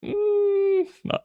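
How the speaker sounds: high mocking squeak of doubt; sarcastic disbelief. Mock disbelief at siege weakness claim.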